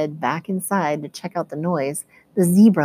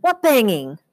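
higher, louder confused yell; 6 semitones higher, 4 dB louder than usual. A narrator's reading. Eddie yells from outside, puzzled and higher. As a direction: shout back, puzzled. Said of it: "Eddie yelled".

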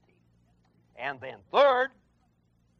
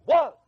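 was said higher and louder on its own; stress on was; 8 semitones higher, 7 dB louder than usual. Shown as a demonstration. Preacher hammering the word was. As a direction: punch the single word was. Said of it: "the emphasis is on the word".

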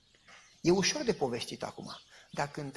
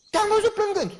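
much higher and louder, pressing the point; insisting dean; 11 dB louder than usual. Retold or imitated. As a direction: push back high and loud. Dean insists, high and loud. (decanul insistă, mult mai sus și mai tare)

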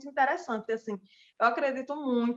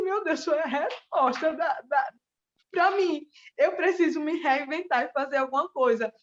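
excited relief; 4 semitones higher than usual. Retold or imitated. Recalling the gift that answered her prayers, excited. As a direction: let the relief pour out.

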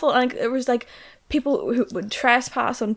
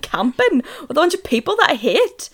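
incredulous; 8 semitones higher, 10 dB louder than usual. Real, not acted. Exasperated rant about hiking.

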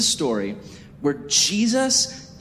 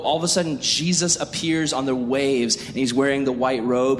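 ordinary delivery

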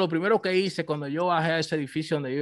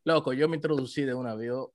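normal talking voice

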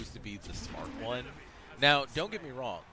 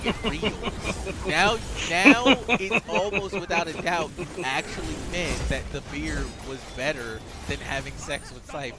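higher, faster, building louder rant; exasperated scolding; 6 semitones higher, 8 dB louder than usual. Retold or imitated. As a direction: lose patience with him. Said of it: "And I'm like".

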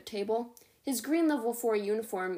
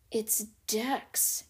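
ordinary delivery, nothing marked

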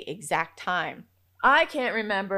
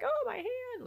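put-on high-pitched little kid voice; small, high mock whimper of a child; imitated toddler; 4 dB quieter than usual. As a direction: mimic a toddler showing a hurt. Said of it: "My two-year-old now".

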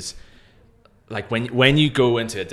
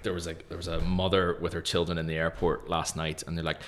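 ordinary talk

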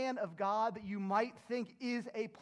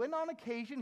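normal talking voice

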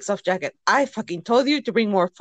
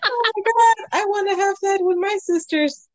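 gushing mock excitement; 11 semitones higher than usual. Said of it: "I'm like".

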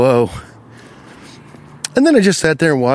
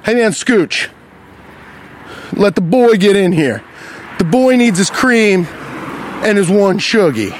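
bossy; 5 semitones higher, slower than usual. Retold or imitated. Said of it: "looking him in his face and saying".

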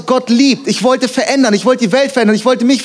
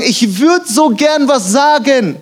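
sehnlicher Wunsch; 6 semitones higher, slower than usual. Retold or imitated. Acting out people unable to speak up.

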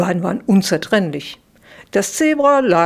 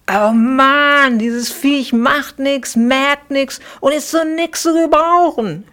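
exasperated, high whiny grumble; groaning complaint; 7 semitones higher than usual. A narrator's reading. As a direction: moan about the useless beast. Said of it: "stöhnte Klacks".

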